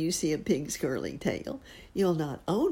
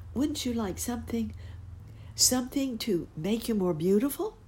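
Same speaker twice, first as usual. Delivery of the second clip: high coaxing fairy voice; tiny tinkling fairy voice; 9 semitones higher than usual. Acted. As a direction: coax him gently, high and sweet. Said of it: "she said".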